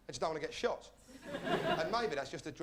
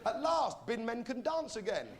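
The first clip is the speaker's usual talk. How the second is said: ordinary talk, nothing marked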